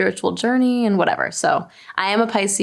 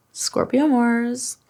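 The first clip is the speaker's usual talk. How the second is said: normal talking voice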